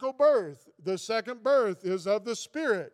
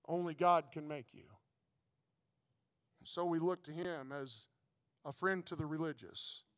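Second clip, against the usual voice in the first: tender preaching; 4 semitones lower, 7 dB quieter than usual. Real, not acted. Soft earnest preaching close.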